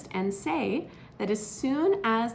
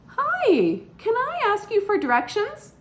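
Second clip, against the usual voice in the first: much higher, louder, eager asking voice; lost stranger; 14 semitones higher, 6 dB louder than usual. Acted. Intruding bird asks the resident for directions, high and eager. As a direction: pipe up brightly like a lost newcomer.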